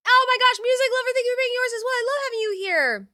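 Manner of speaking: gushing excitement. Real, not acted. Streamer thanking the follower Music Lover, gushing and high. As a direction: squeal a delighted thank-you.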